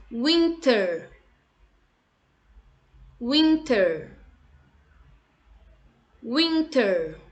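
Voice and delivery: winter said slowly as a model, falling; teacher modelling winter for kids to repeat; model word. Shown as a demonstration. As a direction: Winter with a clear w and t, falling at the end. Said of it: "repete comigo".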